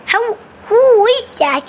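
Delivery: high, squeaky little bird voice; high-voiced chick; young bird character. Young bird meets the newcomer.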